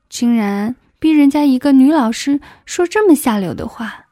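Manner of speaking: plain reading voice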